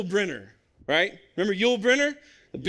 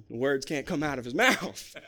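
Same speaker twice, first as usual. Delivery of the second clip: normal talking voice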